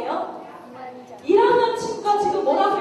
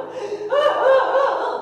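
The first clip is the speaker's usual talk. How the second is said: put-on high, loud child's wailing; acted bawling in long, repeated bursts; loud mock crying; 7 semitones higher, 4 dB louder, much slower than usual. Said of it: "막 큰 소리로 울고". (큰 소리로 엉엉 우는 흉내)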